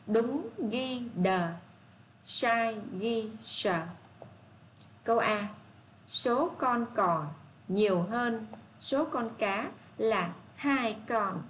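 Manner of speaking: very slow, deliberate dictation to the class; slow read-aloud. Shown as a demonstration. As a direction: read each word out so children can follow. Teacher reads task and statement. (đọc đề thật chậm, rõ từng chữ)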